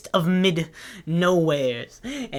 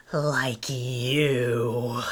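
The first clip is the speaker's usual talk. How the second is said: hushed, voiceless taunt; creepy whisper; 6 semitones lower, 5 dB quieter than usual. Acted. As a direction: breathe it out as a creepy jab.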